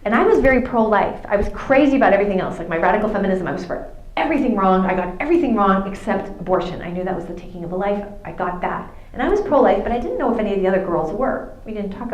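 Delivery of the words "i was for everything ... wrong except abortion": usual speaking voice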